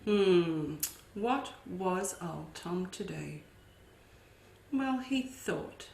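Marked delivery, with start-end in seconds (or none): none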